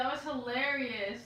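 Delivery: ordinary delivery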